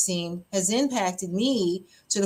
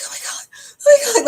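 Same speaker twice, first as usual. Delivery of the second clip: panicked; 8 dB louder, much faster than usual. Retold or imitated. Retelling her terror during storms.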